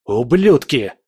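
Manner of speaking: loud burst of cursing; angry curse. A narrator's reading. Hero curses the shadows' masters, angry outburst. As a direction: hurl the insult at the attackers. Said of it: "выругался я".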